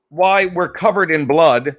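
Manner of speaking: usual speaking voice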